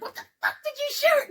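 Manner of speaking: very high-pitched retold outburst; panicked mock shriek. Sister confronts brother about the gunshot, high panicked mock shriek. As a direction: shoot way up in pitch, imitating your own panic.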